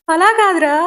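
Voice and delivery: mother-in-law scolding son over pose, shouting; exasperated shout. A narrator's reading. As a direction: cry out no, not like that. Mother correcting poses, exasperated.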